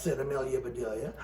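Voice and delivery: ordinary delivery, nothing marked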